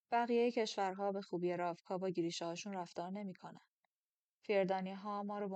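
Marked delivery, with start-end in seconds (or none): none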